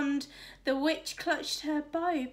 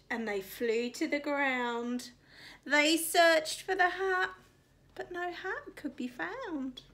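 usual speaking voice

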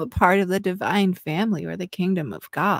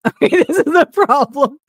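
laughing; 9 semitones higher, 11 dB louder than usual. Real, not acted. Breaks up laughing.

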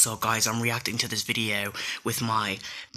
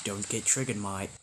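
put-on casual British accent, softer at the end; 3 semitones lower, 6 dB quieter, faster than usual. Warning viewers not to get triggered, in a British accent. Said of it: "talking in my British accent".

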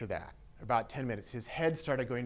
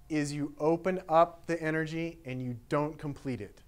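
usual speaking voice

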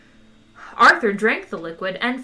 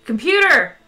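loud higher shout; 5 semitones higher, 8 dB louder than usual. Acted. Zaphod shouting for the ship's computer, loud. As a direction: call the computer across the bridge. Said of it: "shouted Zaphod".

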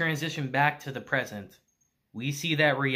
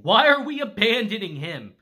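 raised, higher and louder voice; screaming in protest; 5 semitones higher, 6 dB louder than usual. A narrator's reading. Girl objects to abandoning her friend, loud mock scream. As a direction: protest loudly, short of a full scream. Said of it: "she's screaming at him".

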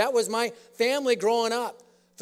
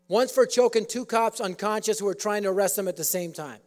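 ordinary talk, nothing marked

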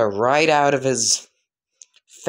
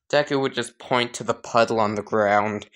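ordinary delivery, nothing marked

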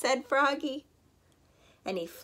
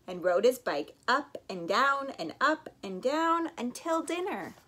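plain reading voice

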